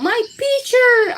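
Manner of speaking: put-on high, loud childish protest; child wailing over her picture; upset child cry. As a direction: cry out like a kid whose drawing is ruined. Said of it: "I say".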